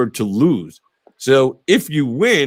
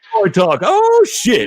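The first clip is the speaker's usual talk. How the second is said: startled outburst; 10 semitones higher, 5 dB louder, slower than usual. Real, not acted. Host startled by story talk.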